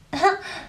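kurzes helles Auflachen; Lachen. A narrator's reading. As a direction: lach kurz und hell auf. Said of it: "lachte sie". (lachend vor Vergnügen)

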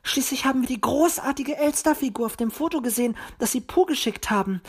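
high, quick little bird voice; peeping. A narrator's reading. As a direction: peep it out high and fast. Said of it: "piepste Zwitschi". (hoch piepsend)

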